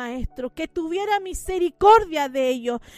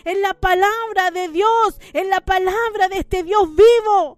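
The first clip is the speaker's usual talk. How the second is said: fervent preaching; 2 semitones higher than usual. Acted. Preacher exhorts with rising force.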